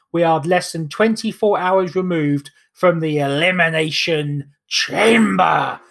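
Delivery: ordinary talk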